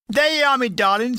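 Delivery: landlady announcing the food to the soldiers; Cockney landlady. A narrator's reading. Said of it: "said our little lady".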